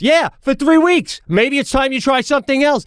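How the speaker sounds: very high, slowed echo; scornful coach. Retold or imitated. Coach throwing the girl's words back at her, high heated retort. Coach mocking daughter in retold quarrel.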